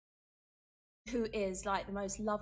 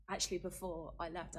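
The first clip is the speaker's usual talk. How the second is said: ordinary delivery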